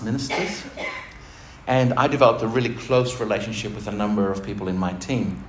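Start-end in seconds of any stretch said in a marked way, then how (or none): none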